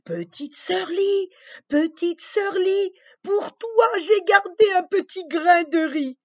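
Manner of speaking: high, gentle bird voice; wild duck voice. Acted. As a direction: call down kindly from the sky. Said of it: "le canard sauvage". (voix aiguë du canard sauvage)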